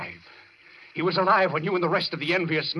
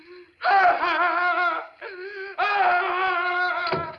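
high, wild crazed laugh tipping into crying; mad laugh; 14 semitones higher, 3 dB louder than usual. Acted. Patient laughing madly at his doctor after the threat. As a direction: cackle like a man losing his mind.

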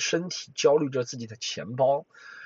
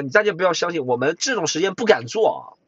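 ordinary talk

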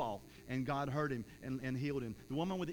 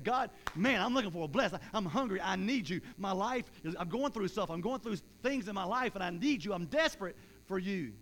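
raised, louder pleading prayer; desperate plea; 4 semitones higher, 4 dB louder than usual. Acted. Preacher acting out a hungry believer's prayer, desperate and urgent. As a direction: cry out to God with urgent need. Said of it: "where we're desperate for God".

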